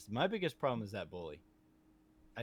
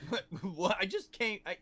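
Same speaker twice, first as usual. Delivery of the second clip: high, louder stammered disbelief; bewildered mock protest; 9 semitones higher, 7 dB louder than usual. New student reacting to a bully's threat, high bewildered protest. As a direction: act a baffled kid, pitched well up.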